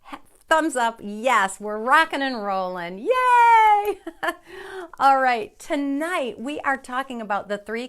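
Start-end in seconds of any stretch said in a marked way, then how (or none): 3.0-4.0 high, ringing yay; delighted cheer. Real, not acted. Host finally heard by viewers, delighted high cheer. 4.0-4.9 short, high-pitched laugh; relieved laugh. Real, not acted. Host after fixing the sound, short high laugh.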